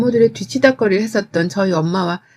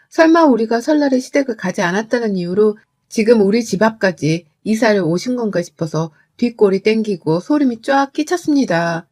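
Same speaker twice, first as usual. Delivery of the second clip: ordinary delivery, nothing marked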